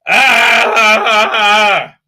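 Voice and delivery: loud, high, drawn-out wailing ah; mock exasperated wail. Owner fed up with runaway dog, mock wail. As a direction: wail in comic frustration. Exasperated cry in retold chase.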